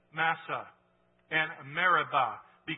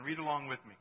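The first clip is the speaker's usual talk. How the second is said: ordinary talk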